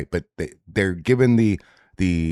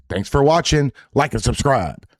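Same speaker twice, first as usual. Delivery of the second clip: upbeat sign-off; 7 semitones higher than usual. Real, not acted. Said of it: "outro sign-off".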